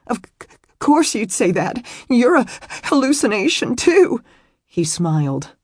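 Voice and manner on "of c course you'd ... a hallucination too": high, stammering wail, rising at the end; tearful protest. A narrator's reading. Weeping woman rejecting the man's reassurance, tearful protest. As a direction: sob it out as an accusation.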